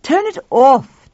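loud, slow order; demanding. Acted. Tuffy ordering Pal, loud slow demand. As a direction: command it firmly. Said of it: "Tuffy demanded".

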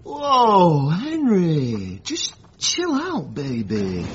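high, swooping, drawn-out woozy delivery; acted stoned drawl. Smoker soothing his angry brother, acting woozy from the cheroot. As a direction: let the words float out lazily, hammed-up stoned.

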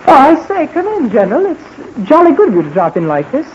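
put-on jolly British accent. Pilot posing as Johnny welcomes the General, mock-British accent. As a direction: greet him like a jolly Englishman, British accent. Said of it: "that was a very good accent".

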